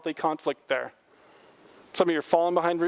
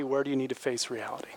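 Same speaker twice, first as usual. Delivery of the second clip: whisper; 4 semitones lower, 6 dB quieter than usual. Real, not acted. Pastor drops to a whisper.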